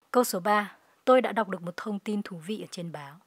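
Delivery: ordinary delivery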